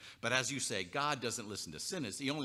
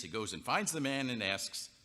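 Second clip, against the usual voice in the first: ordinary delivery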